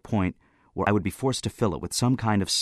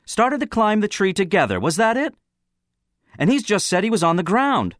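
high, loud, harsh cross-examination; 14 semitones higher, 6 dB louder than usual. A narrator's reading. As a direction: hammer the witness, voice raised. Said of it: "went on harshly".

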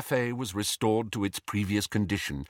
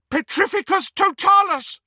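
shrieked, much higher and louder; screamed spell; 6 dB louder than usual. A narrator's reading. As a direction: scream it from hiding to stop him. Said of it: "screamed Hermione from out of sight".

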